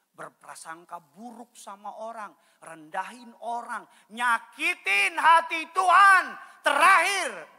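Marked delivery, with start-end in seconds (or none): 4.1-7.6 slow, high, loud peak of a list; climactic preaching shout. Real, not acted. Pastor declaring the last year of hurting God, climactic shout. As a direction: drive it home at full voice.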